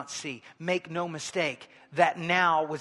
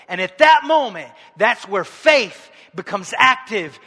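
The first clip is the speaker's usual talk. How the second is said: loud, raised, slow and emphatic preacher's climax; impassioned preaching; 4 semitones higher, 13 dB louder than usual. Acted. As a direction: drive each phrase home to the congregation. Preaches at full force.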